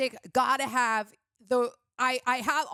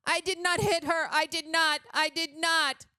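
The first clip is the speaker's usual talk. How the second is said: higher, hammed-up stilted denial; mock indignant actor; 5 semitones higher than usual. Reviewer quoting the film's lead denying he hit her, mock indignant. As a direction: imitate a bad actor's protest.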